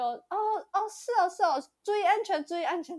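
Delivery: high, offhand brush-off; mock the driver shrugging it off; dismissive driver. Imitating the driver waving it off before leaving, high and offhand.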